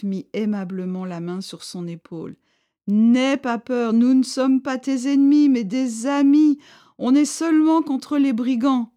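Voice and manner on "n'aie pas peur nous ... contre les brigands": a firm leader insisting he means no harm; chief's voice. A narrator's reading. Chief insists to terrified Bardot, louder and higher.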